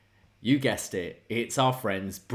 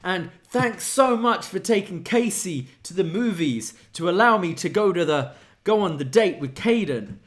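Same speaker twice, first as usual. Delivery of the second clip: higher, chirpy dude voice; mimicking Jensen; 6 semitones higher than usual. Host voicing Jensen replying about his date, higher. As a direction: play the eager friend answering. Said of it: "And he goes".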